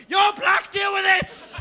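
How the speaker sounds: high, loud shove-off yell; panicked shout. Retold or imitated. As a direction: shout it while pushing your mate forward. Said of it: "I went".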